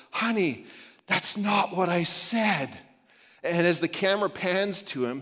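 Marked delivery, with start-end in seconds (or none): 0.1-2.8 frustrated but compassionate. Retold or imitated. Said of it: "frustrated but compassionate male voice".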